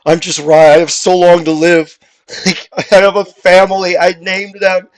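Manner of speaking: high, loud desperate begging; pleading bread. Acted. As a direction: beg for your life as a tiny loaf. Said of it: "the bread starts pleading for its life".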